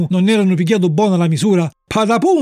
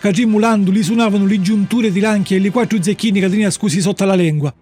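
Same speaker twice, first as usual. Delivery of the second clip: plain reading voice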